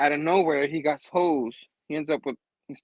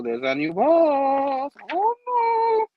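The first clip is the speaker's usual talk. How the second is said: slow, rising into a very high wail; exaggerated falsetto despair; mock dismay; 9 semitones higher, 4 dB louder, much slower than usual. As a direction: draw it out into a hammed-up cry.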